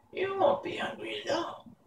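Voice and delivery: high, soft, menacing witch; hint at a threat like a wicked crone; grim witch. Acted. Witch Mombi threatening hungry boy Tip, high and soft. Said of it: "with a grim look".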